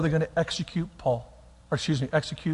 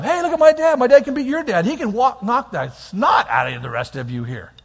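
put-on bragging kid; 5 semitones higher than usual. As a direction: brag to the other kids about your dad. Kid bragging about his dad.

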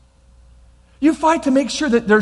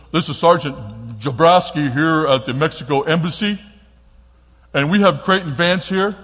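low, official phone voice; embassy sergeant; 5 semitones lower than usual. Retold or imitated. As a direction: imitate an officer identifying himself on a call. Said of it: "to the sergeant here".

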